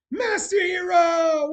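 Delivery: loud, slow, high shout of a name; booming welcome shout; calling out. Real, not acted. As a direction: call his name out across the room. Shouting greeting to a friend.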